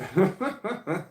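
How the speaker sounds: high loud burst of laughter; real loud laugh. Real, not acted. Laughs about being disturbed.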